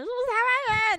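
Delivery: put-on drunken proud shout; loud drunk shout. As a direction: yell it proudly across the dance floor. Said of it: "我很大声说".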